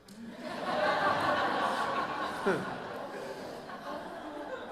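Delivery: high breathy burst of laughter; loud laugh. Real, not acted. Laughs at own selfishness.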